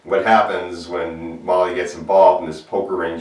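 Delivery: ordinary talk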